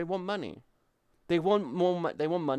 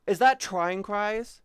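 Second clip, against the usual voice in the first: louder, slow surprised ask; sudden recognition of a name; excited guess at who the streamer is; surprised question; 8 semitones higher, 8 dB louder, slower than usual. Real, not acted.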